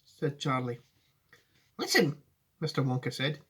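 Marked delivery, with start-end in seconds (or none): 1.8-2.2 put-on a grave old showman, pitched up. Wonka answering Charlie seriously, in a high voice.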